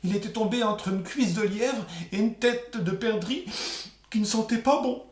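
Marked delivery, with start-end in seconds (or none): none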